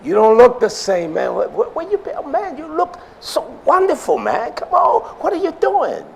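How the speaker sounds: high mock astonishment "where you been"; amazed buddy. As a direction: gush like a friend who hasn't seen you in years. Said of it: "That's the way it was said in my days".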